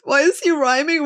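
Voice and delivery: ordinary talk, nothing marked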